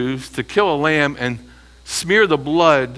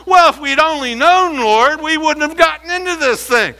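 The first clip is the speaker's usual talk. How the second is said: a hint of a whining plea; lightly voiced pleading excuse; mock wailing plea to the Lord; 12 semitones higher, 4 dB louder than usual. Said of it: "They can't say now".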